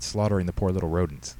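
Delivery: ordinary delivery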